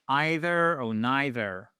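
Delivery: EITHER and NEITHER starting with an eye vowel; long I pronunciation. Shown as a demonstration. English teacher introduces either and neither, eye-vowel variant. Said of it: "qu'on peut aussi prononcer".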